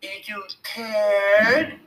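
put-on high, very slow baby-talk taunt; shrill, girlish voice. A narrator's reading. Billy taunting the retreating boys, shrill and girlish. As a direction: draw the teasing question out girlishly. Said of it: "girlish voice".

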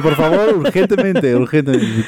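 ordinary delivery

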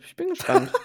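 sing-song it up high. Real, not acted. Repeats phrase, second high. (hoch und verspielt)

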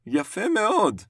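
lifted, high praising voice; high-pitched praise. Acted. Teacher to pupil about his drawing, high-pitched praise.

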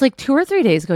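ordinary talk, nothing marked

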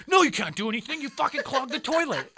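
loud, high angry outburst; acted-out exasperated yell; pissed-off brother. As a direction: snap back furiously at the culprit. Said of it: "my brother's pissed at this point".

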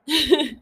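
short, high, loud laugh; quick burst of self-mocking laughter. Real, not acted. Podcaster admitting her trauma shows, short embarrassed laugh.

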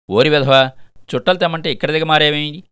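loud, high-pitched telling-off; scolding father. Acted. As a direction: scold the boy loudly. Father scolding son found loitering.

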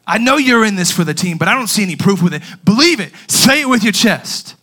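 loud, insistent, rising in pitch; coach exhorting. Retold or imitated. Coach challenging player's belief in team, loud exhorting. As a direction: press the player hard for proof. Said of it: "what my coach was saying".

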